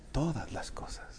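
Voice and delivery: breathless whisper of todas las cosas; hushed awe. Shown as a demonstration. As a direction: let the verse fade into a whisper. Preacher whispers end of verse.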